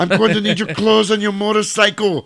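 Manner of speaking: high-pitched comic action-star impression; Arnold impression. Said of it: "And Arnold's like".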